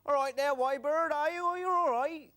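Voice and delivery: loud high cartoon farmer; farmer puppet. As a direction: boom a cheery farmer's greeting. Stick puppet farmer greets.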